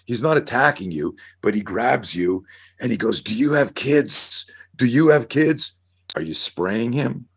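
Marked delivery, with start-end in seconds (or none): 3.2-4.5 slow, higher pleading question; desperate father. Retold or imitated. Father grabbing the officer, higher and slow in desperation. 4.8-5.7 higher and louder demand; desperate father yelling. Retold or imitated. Father grabbing the officer, repeating it higher and louder.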